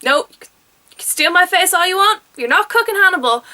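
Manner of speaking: defiant Will. Retold or imitated. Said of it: "And Will's just like".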